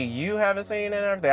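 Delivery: high, fast disbelieving question; can't believe she hasn't seen it; shocked question. Retold or imitated. Viewer asks Tabby, high and fast in disbelief. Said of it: "I was shocked".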